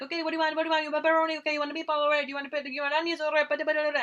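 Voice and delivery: high, rapid-fire counterman barking orders; fast pizza guy. Retold or imitated. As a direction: rattle off the options like a rushed New York pizza guy. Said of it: "fast and furious".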